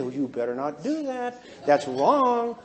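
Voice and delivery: low, slowing voice of conscience; gently scold, drawing out the final words; nagging conscience. Acted. Blue woman's conscience as she speeds, nagging. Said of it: "The old conscience kicks in".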